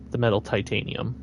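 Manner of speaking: titanium with a long "tie-TAY-nee-um"; deliberate titanium. Shown as a demonstration. Gamer carefully shows his odd word titanium, said "tie-TAY-nee-um". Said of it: "saying this this way".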